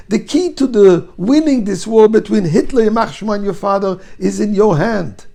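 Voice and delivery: loud, high, fervent plea; impassioned preaching. A narrator's reading. Rabbi pleading with the daughter to save her son, fervent shouting. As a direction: pour out the plea with full force.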